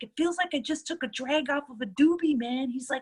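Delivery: high, lightly voiced stoner buddy; imitating a mellow guy. Imitating stoned, relaxed veteran.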